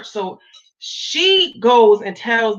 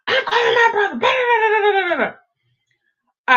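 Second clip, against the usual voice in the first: high, quick, fired-up friend; excited friend; 13 semitones higher than usual. Retold or imitated. Friend reacting to the news, high and fired up. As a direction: mimic a friend ready to call her brother. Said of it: "She was like".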